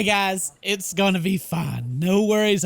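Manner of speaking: usual speaking voice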